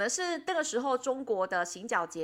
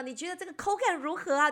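imitating the reporter; 7 semitones higher, 4 dB louder than usual. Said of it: "就叫我们说呢".

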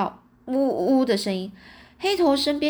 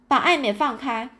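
higher-pitched, restrained shouted order; commanding shout; 4 semitones higher than usual. A narrator's reading. 允琪 orders 赵提 to release 爱美, contained commanding shout. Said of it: "大喝一声".

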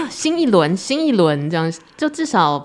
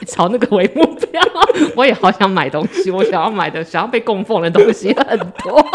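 laughing; 4 semitones higher, 6 dB louder than usual. Real, not acted. Joking about wanting things.

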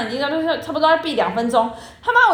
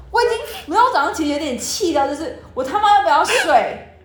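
usual speaking voice

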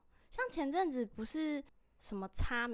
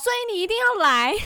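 much higher, loud hammed-up pitch; mock pushy salesman; 11 semitones higher, 17 dB louder than usual. Co-host joking as the bus stranger, loud mock pitch.

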